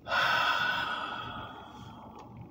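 long airy sigh; deep breath out. Retold or imitated. Man showing the breath before each mantra, deep sigh. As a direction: breathe out deeply and slowly. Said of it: "with deep, deep, deep breaths".

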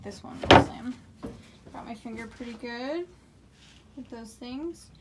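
normal talking voice

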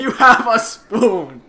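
teasing. Real, not acted. Teasing about the spoon.

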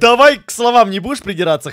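ordinary delivery

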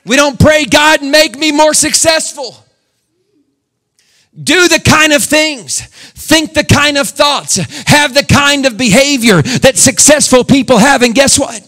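high, loud preacher's cry; shouting sermon pitched high; full-force preaching. Acted. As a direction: preach it out to the rafters.

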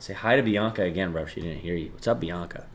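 ordinary talk

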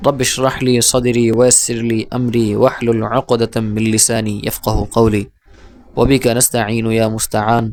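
slow, measured recitation; Arabic supplication. Real, not acted. Teacher opening the class with prayers, slow recitation.

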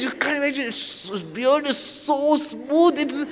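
mock swooning devotee; dreamy girlish gush. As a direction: gush dreamily like a smitten girl. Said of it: "she says".

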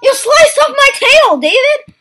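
high-pitched, drawn-out protest; shocked outcry. Real, not acted. Gamer protesting to friend David who sliced him, shocked high outcry. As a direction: cry out high and slow in protest.

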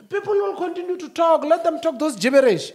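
high, loud defiant rant; heated rant. Real, not acted. Dismissing gossip to a friend, voice climbing loud. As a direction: fire it up, brushing critics off. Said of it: "your voice is going up".

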